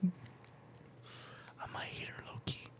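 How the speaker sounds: very soft, voiceless whisper; whispered confession. Real, not acted. Joker admits being a hater, whispered aside. Hushed confession after denying it.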